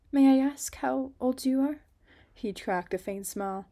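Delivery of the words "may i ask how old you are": put-on higher, louder girl voice; bright girl voice. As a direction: ask like a curious young girl. Girl voice, far timbre.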